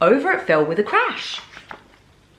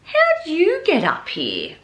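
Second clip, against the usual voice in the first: higher, slow puzzled watchman voice; amused watchman; 8 semitones higher than usual. Acted. Watchman asking the little bear how he got there. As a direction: ask it slowly, puzzled and kind. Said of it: "he said".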